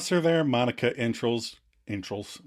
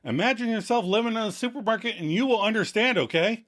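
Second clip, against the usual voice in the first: quick, higher-pitched eager pitch; mock insistent explaining; 8 semitones higher, faster than usual. Host voices Joey explaining to Janine, insistent. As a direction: explain it eagerly, talking fast.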